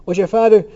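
high, loud question cut short; breaking off. A narrator's reading. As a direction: start asking keenly, then stop yourself. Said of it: "Cranley interrupted himself for an instant".

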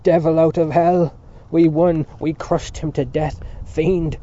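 loud, raging taunts; enraged shout. A narrator's reading. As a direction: shout in furious triumph. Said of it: "shouted from the room".

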